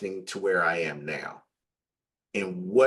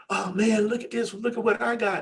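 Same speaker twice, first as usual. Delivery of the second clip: high, eager showing-off voice; excited friend imitation; 14 semitones higher than usual. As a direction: imitate a friend proudly showing his find, pitched way up. Retells friend showing off his results.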